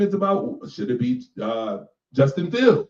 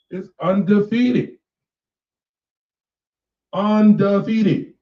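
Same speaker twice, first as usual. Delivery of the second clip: slow, louder, higher undefeated; drawn-out emphasis; 5 semitones higher, 5 dB louder, slower than usual. Real, not acted. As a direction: stretch the word out like a verdict. Repeating the verdict with weight.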